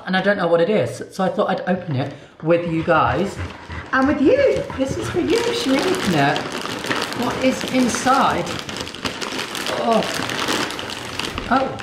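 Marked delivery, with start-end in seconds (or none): 3.9-11.7 high, sing-song voice for the dog; high pet talk. Real, not acted. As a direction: coo at the dog while opening. Talking to her dog Carter.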